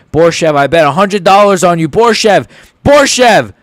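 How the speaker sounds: begging him to hang on; urgent cheer for the fighter; pleading cheer. Real, not acted. Begging his fighter to escape.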